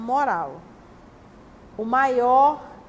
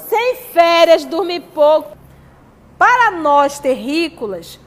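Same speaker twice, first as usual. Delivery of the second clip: emphatic preaching; 9 dB louder than usual. Real, not acted. Pregação enfática sobre orgulho.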